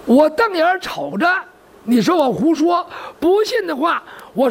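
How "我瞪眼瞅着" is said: ordinary delivery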